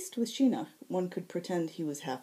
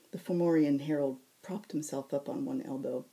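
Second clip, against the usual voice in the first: usual speaking voice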